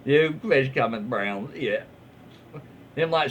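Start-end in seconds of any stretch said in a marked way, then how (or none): none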